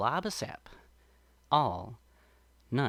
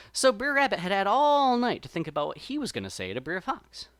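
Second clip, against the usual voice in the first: ordinary delivery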